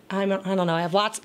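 ordinary talk, nothing marked

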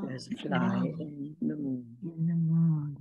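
low slow monotone prayer. Shown as a demonstration. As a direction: chant it low and reverent. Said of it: "your voices went very kind of low".

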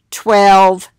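ordinary talk

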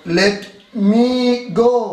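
slow dictation. Shown as a demonstration. Teacher giving students an example sentence, slow dictation. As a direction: stretch each word for writing down.

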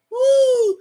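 high loud whoop of delight; excited whoop. Real, not acted. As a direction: whoop with joy at the song. Excited about the song.

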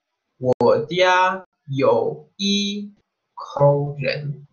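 slow, careful Mandarin answer; model Chinese answer. Shown as a demonstration. Teacher modelling the one-person answer for students. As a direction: lay out each syllable slowly.

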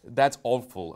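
ordinary talk, nothing marked

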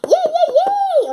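high loud, slow yay yay yay; excited cheer. Acted. Girl cheers because the puppy may come along. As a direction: cheer with delight.

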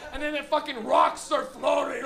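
ordinary talk

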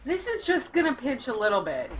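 doctor voice. Pretend. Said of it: "biggest lie ever said by any doctor".

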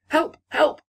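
high, loud cry for help; acted cries of alarm, pitched high. A narrator's reading. Young ladies as the tree catches fire, crying out. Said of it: "cried the young ladies".

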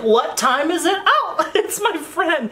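wearing the furry wrap in public, giggling; playful creature voice. Pretend. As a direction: talk to the pet wrap like a pal. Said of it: "Just like".

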